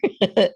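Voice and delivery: a quick bright laugh at herself; short laugh. Real, not acted. Host laughing at her own arm stretch, short laugh. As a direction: laugh lightly mid-sentence.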